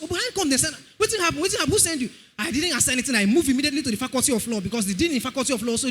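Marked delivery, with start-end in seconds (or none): 0.0-2.1 amazed disbelief. Retold or imitated. Amazed student asking.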